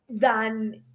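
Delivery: normal talking voice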